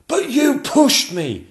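very high, loud, drawn-out protest; a courtier arguing back indignantly; arguing Lord Chamberlain. Acted. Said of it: "argued the Lord Chamberlain".

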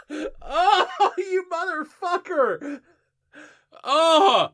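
high laughing wail through pain; half laughing, half wailing; laughing and crying out. Real, not acted. Stung eyes, laughing and yelling.

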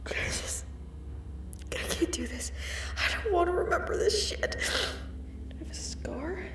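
breathless, frightened hush; barely holding it together; shaken whisper. Real, not acted. Panicking over remembered surgery.

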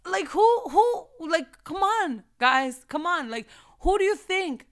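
high, loud exasperated protest; loud indignant. Real, not acted. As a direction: shout it in disbelief. Indignant outburst at Satan.

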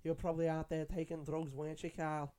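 mock a Scouse grandma needling her grandson about drugs; imitating Scouse grandma. Scouse grandma teasing grandson Carl about drugs, lightly imitated Scouse accent. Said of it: "the way she said drugs".

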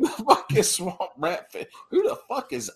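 laughing through the words; cracking up mid-line. Real, not acted. Echoing the heckle, laughing.

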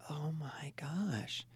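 slow, breathless whispered oh my gosh; stunned whisper. Retold or imitated. Therapist whispering her amazement at the couple. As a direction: gasp it out under your breath, amazed. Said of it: "I'm like".

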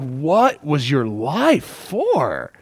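drawn-out, baffled challenge; incredulous questioning. Acted. Preacher voices onlookers questioning Jesus's life, incredulous. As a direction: stretch each word in mock disbelief. Acted scoffing reaction to criminal's death.

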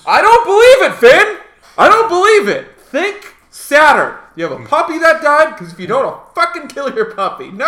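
high, very loud hammed-up yelling; berating shout from the sound booth; screaming director. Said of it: "they're just screaming at him".

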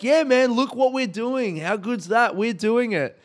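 mock a kid pretending to include his friend; fake excitement. Imitating a kid showing a friend his game, hammed-up excitement. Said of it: "but you're like".

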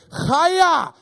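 "Chaya!" shouted high and loud, throaty hawk on the ch. Shown as a demonstration. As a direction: yell "Chaya!" with a throat-clearing rasp. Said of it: "hawking a loogie right before it".

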